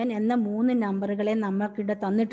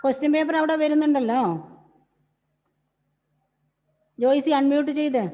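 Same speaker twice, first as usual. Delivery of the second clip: calling out; 4 semitones higher, 5 dB louder than usual. Real, not acted. Teacher calling a student online.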